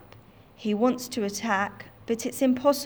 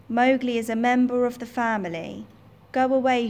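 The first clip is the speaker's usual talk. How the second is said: louder and faster, standing up to the tiger; fierce defiance; 3 semitones higher, 5 dB louder, much faster than usual. Acted. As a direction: drive the tiger off. Father Wolf defends Mowgli, fierce.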